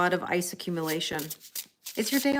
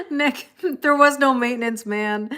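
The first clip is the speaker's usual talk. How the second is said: higher, louder, on the edge of laughter; amused protest; 5 semitones higher, 7 dB louder than usual. Real, not acted. Streamer denying a viewer's maintenance man joke, laughing. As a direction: protest through real amusement.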